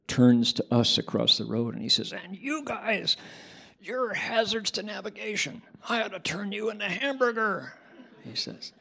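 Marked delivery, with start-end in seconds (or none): 2.1-7.7 a hint of a menacing biker, higher; tough biker. Biker threatening the driver who almost made him crash, lightly menacing.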